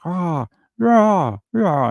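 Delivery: high mock howling "rah rah"; imitated screaming. Imitating the sword attacker howling in the street, mock high howl. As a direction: ape his random wild howls. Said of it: "he's just screaming with this sword".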